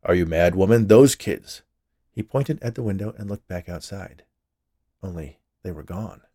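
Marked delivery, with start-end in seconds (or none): 0.0-1.6 loud exasperated husband. A narrator's reading. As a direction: snap loudly at your wife, exasperated. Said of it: "with an exasperated expression".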